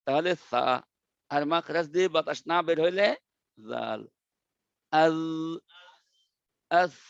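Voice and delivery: আস with air, আল without; air contrast drill. Shown as a demonstration. Teacher contrasting আল and breathy আস. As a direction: breathe out on আস only. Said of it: "সা পড়ার সময় বাতাস লাগবে".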